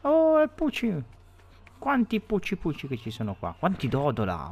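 put-on baby talk to pet. Parla ai dodo come cuccioli.

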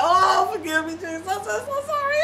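high, breaking, sobbing plea for forgiveness; hammed-up ugly cry begging forgiveness. Teen begging Jesus for forgiveness during prayer, ugly cry. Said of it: "Ugly cry".

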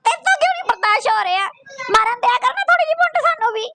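high-pitched mock complaint; hammed-up, pleading imitation; mocking whine. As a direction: whine her complaint back at her. Mocks the clip's tearful complaint.

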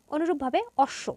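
ordinary talk

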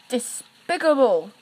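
loud scornful huff; huffy. Acted. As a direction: spit it out in contempt. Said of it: "huffed Henry".

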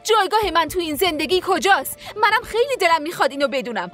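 rising upset. Acted. Wife confronts husband in quarrel.

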